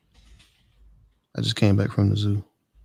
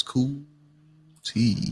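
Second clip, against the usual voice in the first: smooth voice; 6 dB quieter than usual. Shown as a demonstration. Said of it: "You gotta say it with a smooth voice".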